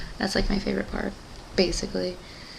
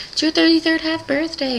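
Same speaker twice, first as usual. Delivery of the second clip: high, chirpy mock-mom announcement; mock celebratory mom voice; imitated excited mom; 8 semitones higher than usual. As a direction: imitate a mom making a big deal of it.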